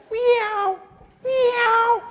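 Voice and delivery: high, squeaky put-on meow; escaping prisoner faking a cat's meow, high and loud. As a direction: pretend to be a cat to fool the guards. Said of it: "he says".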